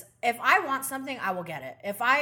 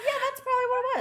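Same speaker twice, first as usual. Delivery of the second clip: very high-pitched, unconvinced agreeing; over-eager agreement; 13 semitones higher, much faster than usual. Retold or imitated. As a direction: agree way up high, convincing yourself. Retold over-eager agreement when consoled.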